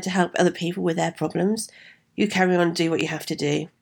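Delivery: ordinary delivery, nothing marked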